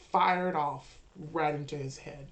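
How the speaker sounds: ordinary talk, nothing marked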